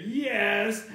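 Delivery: loud, high, drawn-out insistence; emphatic acted holler; arguing cashier. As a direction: shout back stubbornly. Cashier in escalating yelling argument.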